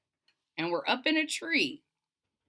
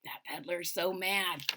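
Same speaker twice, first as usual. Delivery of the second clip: hushed aside; 6 semitones lower, 8 dB quieter than usual. A narrator's reading. Hushed aside about peddler.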